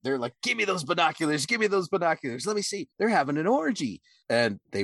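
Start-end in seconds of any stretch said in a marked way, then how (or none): 0.4-4.0 pitched up high, eager and pushy; snatch the binoculars, dying to see; a buddy hammily begging to look, high and eager; excited grab. Retold or imitated. Friend grabbing binoculars, excited.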